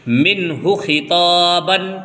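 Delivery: منہ خطابن chanted slowly, ہو kept short and the تا drawn out; slow chanted Quran reading; slow recitation demo. Shown as a demonstration. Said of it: "تا کھینچے گا".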